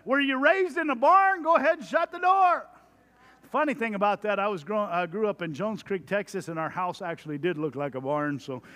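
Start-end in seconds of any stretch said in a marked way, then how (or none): 0.1-2.7 high, loud fatherly scolding; dad voice. Retold or imitated. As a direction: snap at the kid to shut the door. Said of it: "Or I'll say like this".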